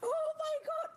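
very high crying exclamation; shrill outcry. Real, not acted. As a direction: cry out in alarm. Cry out.

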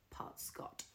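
whisper. Real, not acted. Confiding aside about Scottish roots.

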